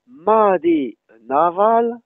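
"madé" with a long, drawn-out A; slow example, stretching the A in "madé". Shown as a demonstration. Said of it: "On a un A long dans mat".

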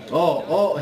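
ordinary talk

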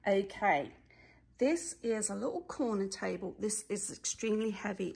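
usual speaking voice

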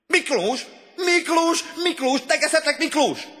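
very high, loud shriek; egret-like screech. A narrator's reading. Head of the coat pyramid hailing Miklós, screeching. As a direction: screech the name like a bird. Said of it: "kulcsagrikoltást hallatott".

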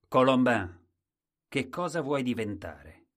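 loud royal call; the king calling Colombin loudly by name. Acted. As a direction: summon him across the hall.

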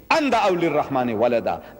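fast Arabic recitation of the verse; Quran recitation. Acted. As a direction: recite the verse swiftly and evenly.

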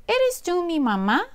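high, hopeful chick question; high little bird asking. Acted. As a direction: ask the cow like a tiny lost bird. Little bird asks the cow.

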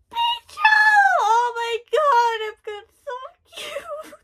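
very high, tearful gushing; real emotional squeal. Real, not acted. Fan seeing a beloved cute character, tearful squeal.

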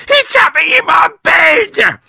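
very high, loud panic; screaming. A narrator's reading. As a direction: shriek it in terror. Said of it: "screamed Missus Twit".

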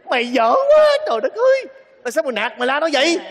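loud, high angry shout; outraged yell at the son; furious scolding. Acted. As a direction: tear into him, shouting. Father furiously scolding his son.

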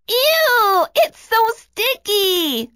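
frozen villain whimpering in defeat; defeated whine. A narrator's reading. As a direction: whine it, beaten and sticky.

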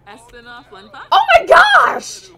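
very loud, high, drawn-out outcry; horrified shout. Pretend. Recoiling from someone's disgusting teeth, horrified shout. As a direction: shriek it, stretching out GOSH.

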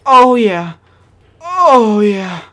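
real gamer glee peaking high and loud; squealing delight. Real, not acted. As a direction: let it burst out in a squeal. Savoring a gun while playing.

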